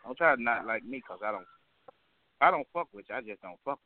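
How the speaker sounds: ordinary talk, nothing marked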